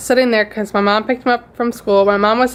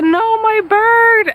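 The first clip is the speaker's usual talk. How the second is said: higher, louder and slow, a mock tearful wail; retold crying answer; 10 semitones higher, 4 dB louder, slower than usual. Telling the neighbor it's the bird, higher and louder in a tearful wail. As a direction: stretch it out like you're sobbing to the neighbor. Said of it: "as I'm crying".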